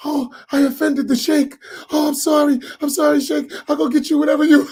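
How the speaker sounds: high, breathless, groveling apology; flustered apology. Retold or imitated. Host groveling to the sheikh, high fluttering apology. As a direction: grovel and fuss over the sheikh. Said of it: "They like".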